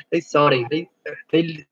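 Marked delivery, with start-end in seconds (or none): 0.2-0.7 amused Scottish "sorry" with tapped R; Scottish-style "sorry", R hard and tapped; Scottish accent. Shown as a demonstration. Tutor tickled by how Scots say sorry, Scottish accent. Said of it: "they pronounce really hard R's".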